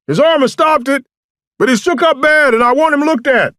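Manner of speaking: very high, loud holler; shouted report. A narrator's reading. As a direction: call the news across the woods. Shouted exchange across the woods.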